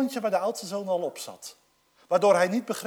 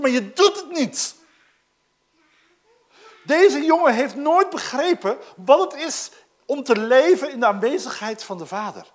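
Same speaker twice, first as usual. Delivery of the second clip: normal talking voice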